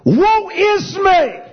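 very high, loud, slow wail; anguished cry. Retold or imitated. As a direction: cry it out in despair. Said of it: "again he reiterates".

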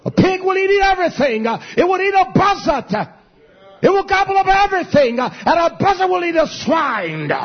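a preacher railing at his congregation; fiery preaching. Real, not acted. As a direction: build it up to a shout. Preaching rant building to shouts.

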